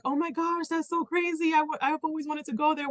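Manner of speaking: high, thrilled and gushing; excited gush. Retold or imitated. Imitating the woman's thrilled reply about New York. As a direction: sound starry-eyed about New York. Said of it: "she's like".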